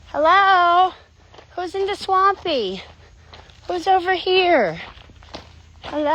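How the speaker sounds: high, loud calling out; calling to find the pig. Real, not acted. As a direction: call across the swamp.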